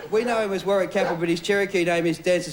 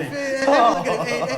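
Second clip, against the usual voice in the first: calling out; 6 semitones higher, 5 dB louder than usual. Real, not acted. Calling attention to Ed.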